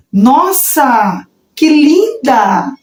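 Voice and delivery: drawn-out, rising admiration; acted wow of delight; admiring expression. Shown as a demonstration. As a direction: gush slowly over something lovely. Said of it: "É a minha expressão".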